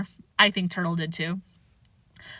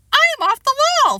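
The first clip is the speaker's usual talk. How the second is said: put-on high loud gleeful child repeat; proud repeat; 6 dB louder than usual. Boy repeats his new phrase, gleeful child voice. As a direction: say it again with glee. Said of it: "Fudge repeated".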